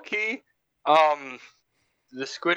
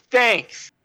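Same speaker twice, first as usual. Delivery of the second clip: mocking echo; 9 semitones higher than usual. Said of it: "Thanks, Chainsaw Man".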